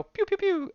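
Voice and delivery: high-pitched "pew" noises in quick bursts; playful high zipping noises; pew-pew sound effect. Retold or imitated. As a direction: mouth little high zaps. Mimics fast fight noises.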